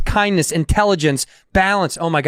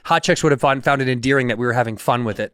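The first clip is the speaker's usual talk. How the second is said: ordinary talk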